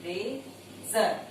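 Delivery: Razor stretched out very slowly; slow dictation. Shown as a demonstration. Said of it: "word है razor". (Razor को बहुत धीरे बोलिए)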